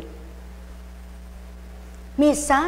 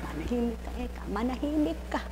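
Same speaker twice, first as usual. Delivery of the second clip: hushed telling-off; 12 dB quieter, faster than usual. Retold or imitated. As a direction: say it under your breath, well below normal volume. Repeats the order to keep quiet.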